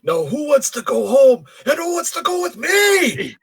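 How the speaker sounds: rally the troops at full voice; rousing speech. Retold or imitated. Reciting the big movie speech.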